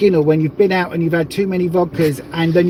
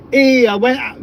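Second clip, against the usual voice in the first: put-on higher, broad Yorkshire accent; 5 semitones higher than usual. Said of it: "you gotta do it in a Yorkshire accent".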